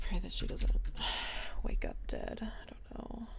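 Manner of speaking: very soft whisper; hushed, voiceless aside to oneself; whispered worry. Real, not acted. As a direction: drop away into a whisper. Trailing worry about her character.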